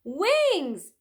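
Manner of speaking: high, loud joyful cry; a girl shouting with glee; excited shout. Acted. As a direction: burst out with delight. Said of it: "said Annie".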